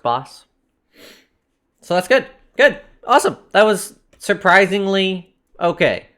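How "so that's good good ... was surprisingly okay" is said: excited. Real, not acted. Surviving a fight.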